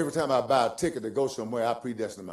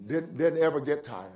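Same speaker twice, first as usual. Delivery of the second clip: usual speaking voice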